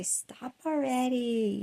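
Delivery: family mocking her nausea talk, exasperated. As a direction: imitate the family fed up with her complaints. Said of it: "they'll say".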